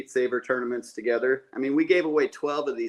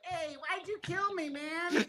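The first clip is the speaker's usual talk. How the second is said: high, softer mock whine; little kid; 8 dB quieter, slower than usual. Little kid killed in Minecraft protesting, high mock complaint. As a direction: complain like a wronged little gamer. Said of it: "these little kids are like".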